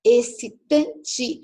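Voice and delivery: excitante said slowly and loud, syllable by syllable; slow syllable demo. Shown as a demonstration. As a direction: drag out excitante for the class.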